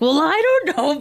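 high-pitched mock protest; defensive boyfriend. Boyfriend answering the raffle seller, high defensive protest. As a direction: imitate a clueless boyfriend, voice up high. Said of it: "And he's like".

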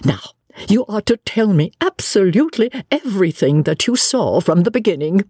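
gush, then press eagerly for every detail; eager lady novelist. Acted. Greets Lucy eagerly, demands account.

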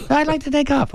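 high squeaky nervous voice. Shown as a demonstration. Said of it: "try not to have a squeaky voice".

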